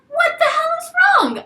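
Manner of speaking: alarmed, shrill-free high exclamation; shocked outburst. Retold or imitated. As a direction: cry out in startled disbelief. Shocked he is crying.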